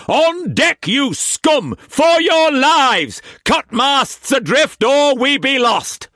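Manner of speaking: high, loud, slow fury; railing at the crew. A narrator's reading. Brock railing at the petrified crew, furious. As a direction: drive the men up. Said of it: "railed at the petrified crew".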